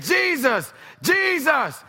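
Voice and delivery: high loud call of the name. Acted. Blind man calling Jesus through the crowd, high shout.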